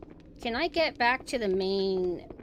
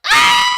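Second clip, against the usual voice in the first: startled scream; 17 dB louder than usual. Real, not acted. Sudden scare in game.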